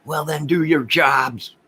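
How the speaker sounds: a fed-up citizen telling police off; indignant demand. Real, not acted.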